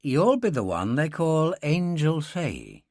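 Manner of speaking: slow, easy Irish man's voice; a light hint of an Irish drawl; Irish lilt. Dylan sizing up the girl, light Irish lilt.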